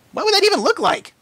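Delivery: high squeaky doubting filly; small high voice, unconvinced; skeptical filly. A narrator's reading. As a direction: ask it like a little skeptic pony.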